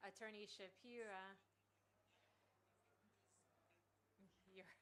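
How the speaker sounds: lightly imitated South African accent; a hint of a South African judge. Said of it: "in his South African accent".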